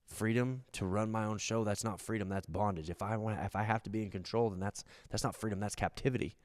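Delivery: low, soft confession; quiet realization. Retold or imitated. Repentant thief realizing bondage, hushed. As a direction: murmur it as a man seeing clearly. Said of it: "he goes".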